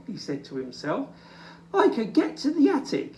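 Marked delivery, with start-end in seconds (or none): 1.7-3.1 high, fast eager scheming; eager plan. Acted. Little Bear planning to himself, eager. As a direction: rush it out excitedly.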